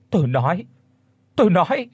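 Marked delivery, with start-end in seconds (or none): 1.4-1.9 high, loud cry of "tôi nói". A narrator's reading. Thu screams to stop the stick, high and loud. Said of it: "thì cô hét lên".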